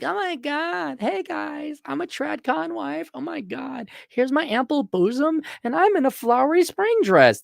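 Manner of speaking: high, breathy-free mock girly voice; mocking influencer wives with a high voice; mock trad wife. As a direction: gush like a fake trad con wife.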